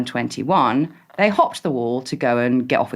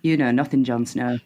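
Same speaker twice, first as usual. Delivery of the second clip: put-on Sheffield accent; 3 semitones lower than usual. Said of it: "Can you say ... in a Sheffield accent".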